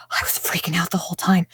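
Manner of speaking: hushed, breathy confession; whisper. Retold or imitated. Husband confessing his massage panic, whispered. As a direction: whisper it like a guilty secret.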